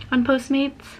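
ordinary talk, nothing marked

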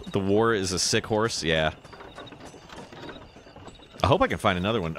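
normal talking voice

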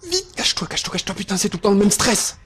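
frantic high-pitched panic; urgent, scared, rushing to hide; panicked. Real, not acted. Hiding from pursuer in panic.

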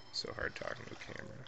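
half-whispered, fast grumble; muttered aside. Real, not acted. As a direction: mutter it to yourself. Aside muttered under breath.

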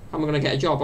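ordinary talk

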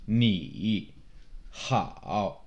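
"Ni hao" low, dropping to the bottom then back up; dipping third tone. Shown as a demonstration. Teacher showing the third tone, low and dipping. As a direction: draw out "Ni" and "hao", each dipping low and rising. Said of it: "bottoms out in your voice and then comes back up".

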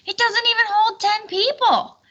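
high, loud exasperated huff; huffy complaint. Acted. Sir Tangent huffs at the tiny tent, exasperated and loud. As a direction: huff it out in disgust. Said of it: "huffed Sir Tangent".

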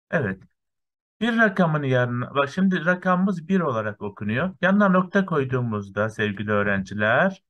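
ordinary delivery

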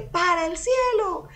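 crowd shout back. Retold or imitated. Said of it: "todo el mundo tenía que gritarle".

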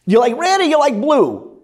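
loud, high Brooklyn sales pitch; Brooklyn salesman. Acted. Vacuum cleaner guy pitching at the customer's house, loud Brooklyn accent. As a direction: push the choice like a pushy Brooklyn vacuum seller. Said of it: "I made him from Brooklyn".

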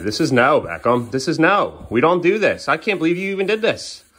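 loud, firm parental reprimand; building, exasperated scolding; stern telling-off. Real, not acted. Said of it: "I need him to know this is not okay".

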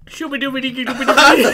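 fake Latin. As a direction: rattle off mock Latin gibberish through laughter. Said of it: "I just spoke Latin".

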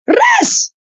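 "Rest." leapt up high, rising and mocking. Real, not acted. To Mama Doze, mocking her in a high voice. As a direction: fling "Rest." up high like a taunt. Repeats rest, much higher.

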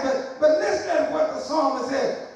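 ordinary delivery

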